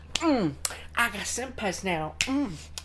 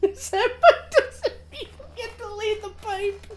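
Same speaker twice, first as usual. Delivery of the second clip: laughing; 10 semitones higher, 4 dB louder than usual. Real, not acted. Laughing over in-game dating joke.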